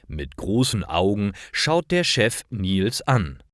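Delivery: ordinary talk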